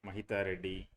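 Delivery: ordinary delivery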